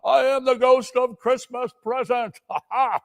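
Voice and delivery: high, loud booming laughter; jolly giant ghost bellowing a welcome. Acted. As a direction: laugh him into the room. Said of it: "bellowed out at him".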